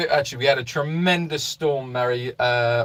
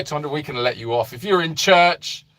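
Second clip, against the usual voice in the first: ordinary talk, nothing marked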